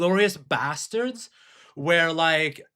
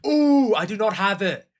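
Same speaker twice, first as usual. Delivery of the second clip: excited surprise; 6 semitones higher, 6 dB louder than usual. Real, not acted. Finds game missing on Steam.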